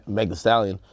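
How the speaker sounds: ordinary delivery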